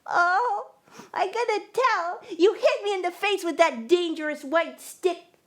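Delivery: high, put-on whimpering child, fake sobs on "Oh"; fake whiny crying. Neighbor girl tattling to the blind kid, fake whiny crying. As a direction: whine like a little girl pretending to be hurt. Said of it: "She started to cry in a fake, whiny way".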